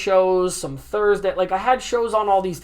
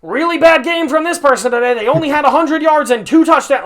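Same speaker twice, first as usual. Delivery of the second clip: higher, louder nerdy sportscaster; dweeb reporter voice; 6 semitones higher, 5 dB louder than usual. Retold or imitated. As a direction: play a smug know-it-all analyst. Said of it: "your typical reporter that ... is like".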